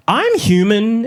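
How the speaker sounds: high, suspicious evil-spirit claim; mock spirit posing as a person. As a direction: protest too much, pitched high. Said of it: "exactly what an evil spirit would say".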